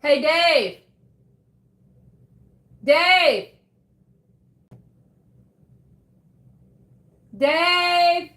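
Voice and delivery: loud call across the house, high and sharp; yelling for Dave. Real, not acted. Calling to Dave elsewhere in the house, loud shout. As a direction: shout his name to reach him in another room. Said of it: "Sorry if I'm yelling in y'all's ear".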